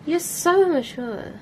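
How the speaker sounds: drawn-out mocking "You're so immature"; teasing immature jab. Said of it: "That sounded s-, that sounded so".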